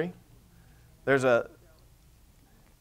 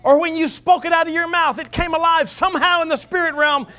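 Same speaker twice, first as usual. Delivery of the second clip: fervent preaching; 10 semitones higher, 4 dB louder than usual. Real, not acted. Preacher exhorts the congregation about faith, fervent and raised. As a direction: preach it with rising fervour.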